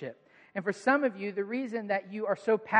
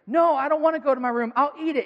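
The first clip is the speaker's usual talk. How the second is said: put-on high, loud little-kid protest; protesting child; 6 semitones higher, 7 dB louder than usual. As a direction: imitate a son refusing to go to his room. Acting son refusing his punishment.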